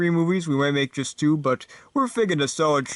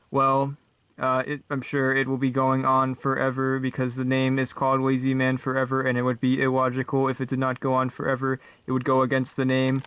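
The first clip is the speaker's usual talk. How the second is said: robot. Acted. Robot guest answering the host's joke, monotone robot. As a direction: say it like a humourless robot, unvarying pitch. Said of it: "guest star for today is this robot guy".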